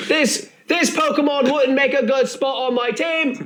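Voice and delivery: high, silly parody voice; Esperoba from Yu-Gi-Oh Abridged. Acted. Proving two characters share a voice, high mimicry. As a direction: keep up the high cartoon boy voice. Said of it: "It's the same voice".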